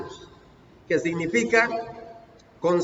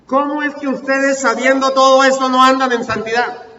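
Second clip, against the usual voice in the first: loud, high rhetorical challenge; exclaimed question; 5 semitones higher, 7 dB louder than usual. Acted. Preacher puts the Lord's question to the church, exclaimed challenge. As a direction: demand it of the congregation, louder and higher. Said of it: "y también una exclamación".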